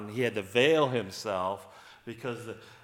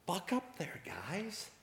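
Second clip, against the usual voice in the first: whispered, softer encouragement; 7 semitones higher, 8 dB quieter, slower than usual. Retold or imitated. Jesus whispers to scared disciples, soft whisper. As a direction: hush them gently.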